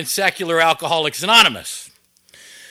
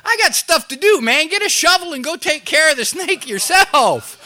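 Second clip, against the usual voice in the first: mock selfish thought; 8 semitones higher than usual. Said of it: "And I'm thinking".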